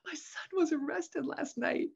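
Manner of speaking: high, weepy mock confession; imitated tearful voice. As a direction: squeak it out as if crying. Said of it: "I was crying on the way".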